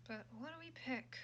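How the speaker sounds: hushed. Real, not acted. Streamer quietly asking what to pick. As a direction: ask it under your breath.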